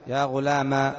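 "يا غلاما" ending on a long level aa; final alif. Shown as a demonstration. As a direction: hold the last vowel of "يا غلاما". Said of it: "وإيش هذه الفتحة؟".

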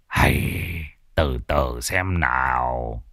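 low, slow, unhurried master's voice; calm old shaman. Acted. Old shaman calms the panicked father, low and slow. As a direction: settle him down, slowly and low.

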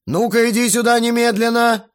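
loud, high-pitched summons; stern call. A narrator's reading. Guest orders the cheeky teenager over, loud and commanding. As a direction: call the boy over sharply and loudly. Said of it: "окликнул меня Грохотов-старший".

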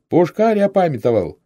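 high, loud call to comrades; excited soldier's shout. A narrator's reading. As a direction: cry out the news across the camp. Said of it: "кто-то крикнул".

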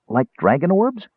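high, curious interjection, rising at the end; eager kender query; interrupting kender. A narrator's reading. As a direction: cut in with a high, innocent question. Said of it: "Tass interrupted".

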